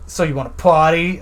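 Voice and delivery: ordinary delivery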